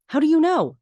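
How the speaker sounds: retold panicked outburst. Lorelai panicking at calm Luke.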